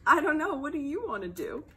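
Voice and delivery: ordinary delivery, nothing marked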